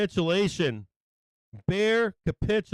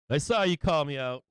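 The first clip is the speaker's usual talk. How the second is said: ordinary talk, nothing marked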